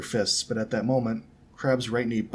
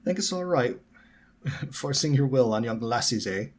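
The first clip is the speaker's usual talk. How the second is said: put-on challenging growl in a Scottish accent; 4 semitones higher, 4 dB louder than usual. A narrator's reading. Scottish boy confronts Malfoy, growled Scottish accent. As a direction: growl it like an indignant Scot. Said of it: "with a distinct Scottish accent".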